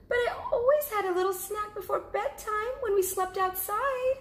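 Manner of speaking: high, small cub voice; little cub asking. Acted. Cub asking mother about sleeping outside, high little voice. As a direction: ask like a curious little bear cub. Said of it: "said Baby Bear".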